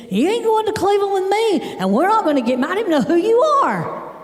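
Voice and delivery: hammed-up high-pitched protest; indignant refusal. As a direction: imitate an outraged dad, pitched high. Father's retold angry refusal.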